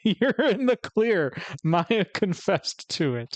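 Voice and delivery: slow, high, laughing through the words; laughing tease. Real, not acted. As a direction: giggle while teasing him. Streamer teasing the doctor.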